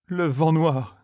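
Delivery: Le vent noir echoed high, as if turned to stone; petrified echo; stunned repeat. A narrator's reading. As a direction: repeat it frozen with dread. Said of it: "comme pétrifié".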